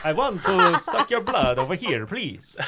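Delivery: loud, high, laughing Italian fan voice; Italian soccer fan accent. Pretend. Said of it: "I sound like an Italian, uh, soccer fan".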